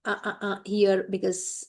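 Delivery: ordinary talk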